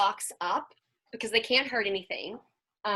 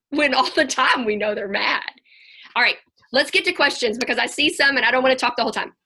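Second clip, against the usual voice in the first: ordinary talk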